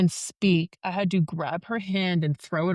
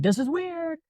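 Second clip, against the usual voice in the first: high-pitched mock panic; retold panic; 10 semitones higher than usual. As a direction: repeat it in a high, strained retelling of panic. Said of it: "I start panicking and I just keep going".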